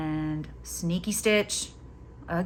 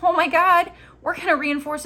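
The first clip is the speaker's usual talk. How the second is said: high, loud burst of excitement; sudden delighted exclamation; excited; 10 semitones higher, 6 dB louder than usual. Real, not acted. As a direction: blurt it out thrilled. Excited self-answer.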